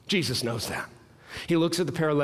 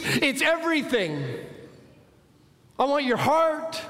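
high, driving sermon delivery; impassioned preaching; 9 semitones higher than usual. Acted. Preacher voicing a call for total transformation, impassioned. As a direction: preach it in a high, urgent pitch.

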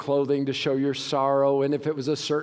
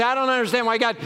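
mock complaining churchgoer; whiny complaint; 9 semitones higher, 4 dB louder, faster than usual. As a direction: voice a whining believer, higher and louder. Congregant's complaint voiced by preacher.

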